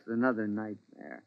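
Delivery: drops to a tired, hushed echo; weary murmur. Acted. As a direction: echo it softly, worn out. Husband echoes wearily.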